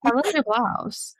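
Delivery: playful sing-song compliment; mock compliment. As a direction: gush over his shirt teasingly. Teasing a man about his shirt.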